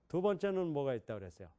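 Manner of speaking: ordinary delivery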